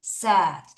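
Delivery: said with a short e, "sed"; pronunciation of said. Shown as a demonstration. Teacher contrasting past with present for learners, said pronounced "sed". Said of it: "요렇게 발음을 합니다".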